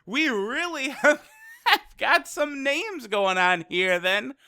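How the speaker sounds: high, drawn-out sceptical okay, easing down; mock-impressed, stretching the words; bemused reaction. Viewer reacting to silly attack names, bemused. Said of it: "I'm like".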